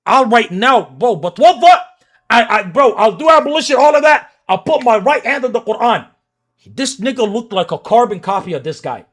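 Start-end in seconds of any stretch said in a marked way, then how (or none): 0.1-6.1 loud insistence. Real, not acted. Swearing oaths in heated argument.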